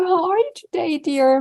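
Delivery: high, sugary, pitying question; mock sweet concern. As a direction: coddle them with exaggerated sweetness. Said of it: "to not ask you".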